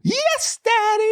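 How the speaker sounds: put-on squeaky high little-girl voice; imitating his little daughter, very high; child's voice. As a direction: pipe it up like a small daughter. Daughter's reply in retold scene.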